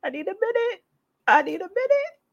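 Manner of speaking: very high, choked-up squeak; sigh, then squeak it out, overcome; teary, overwhelmed. Real, not acted. Vlogger needing a minute over corn-free pizza, overwhelmed and teary. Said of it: "I'm getting teary-eyed".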